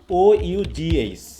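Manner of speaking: ও ইউ জি এইচ spelled slowly, letter by letter; slow letter spelling. Shown as a demonstration. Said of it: "এই উচ্চারণটা কি হবে".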